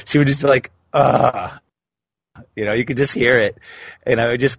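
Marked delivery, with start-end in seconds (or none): 0.9-1.6 mock annoyed sigh of a tired clerk; annoyed groan. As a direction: imitate her fed-up "Ugh". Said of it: "you should have heard the annoyance on her voice".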